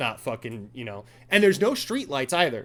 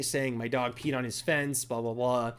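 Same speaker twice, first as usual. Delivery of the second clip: ordinary delivery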